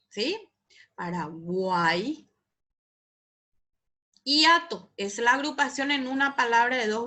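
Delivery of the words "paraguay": slow, low "Paraguay" with a long guay; drawn-out triphthong. Shown as a demonstration. Teacher repeating a triphthong example, slow drawn-out guay. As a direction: stretch "Paraguay", gliding through guay as one syllable.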